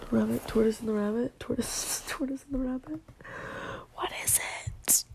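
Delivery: faint whispered mumbling, thinking aloud; muttering to herself. Real, not acted. Vlogger trying to recall the fable's name, muttering to herself. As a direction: mumble under your breath while searching memory.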